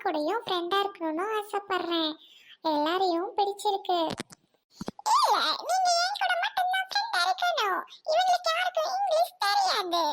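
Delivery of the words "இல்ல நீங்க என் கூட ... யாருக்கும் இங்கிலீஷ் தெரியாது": very high, sustained character squeal; possessive insistence. Acted. Insists on being the only friend. (மிக உச்சக் குரலில் பிடிவாதம்)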